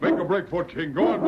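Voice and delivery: high, urgent yell to the dog; urgent shout. Acted. Urging dog to escape.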